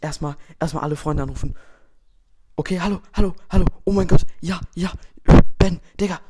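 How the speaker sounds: loud, fast-talking hype on the phone; bursting with excitement, calling everyone; hyped excitement. Acted. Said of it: "der direkt alle seine Freunde anruft".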